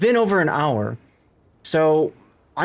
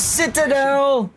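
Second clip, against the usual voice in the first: excited shout; 9 semitones higher, much slower than usual. Real, not acted. Big hit in the game.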